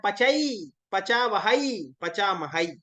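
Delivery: पचई with the ending opened into a drawn-out "ai"; correct pronunciation demo. Shown as a demonstration. Teacher showing students how to say it, पचई with a full "ai" ending. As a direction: stretch the final "ai" in पचई, वहाई, महाई. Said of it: "ऐसे उच्चारण करना है".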